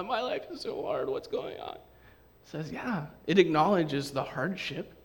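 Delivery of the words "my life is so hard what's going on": whining high then dropping to a breathy whisper; mock whine. Mimicking whiny complaining, high then whispered. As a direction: complain like a sulky child. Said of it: "You know".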